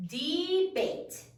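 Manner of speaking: "Debate" drawn into DEE-BATE; syllable by syllable. Shown as a demonstration. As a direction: say "Debate" in two full beats. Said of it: "How many syllables are there".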